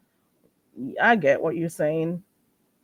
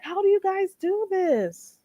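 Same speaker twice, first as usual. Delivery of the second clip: mock disbelief; 13 semitones higher than usual. Her own reaction to the pair, high baffled question. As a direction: ask it like you're baffled by them. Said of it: "And I'm like".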